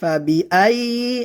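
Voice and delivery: "ফাবিআইয়ি" chanted with the voice running on; continuous sound. Shown as a demonstration. Teacher showing learners "ফাবিআইয়ি" without a break. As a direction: keep sound flowing through the double y of "ফাবিআইয়ি". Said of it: "আওয়াজ জারি থাকবে".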